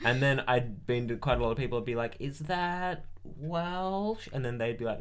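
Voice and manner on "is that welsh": high, very drawn-out question; mock hesitant guess. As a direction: stretch it out like an unsure guesser. Imitates people guessing an accent.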